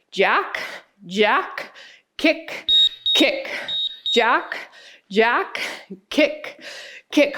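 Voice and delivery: rhythmic cueing of moves; counting workout reps. Real, not acted. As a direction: call out the moves in time. Calling the exercise moves in rhythm.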